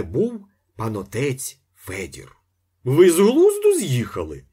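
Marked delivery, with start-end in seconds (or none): 2.8-4.4 loud, much higher startled cry. A narrator's reading. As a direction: yelp in shock as you jump up. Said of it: "скрикнув інженер".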